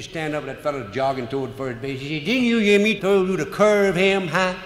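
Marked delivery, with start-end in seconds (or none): 2.3-4.5 scolding catcher. Retold or imitated.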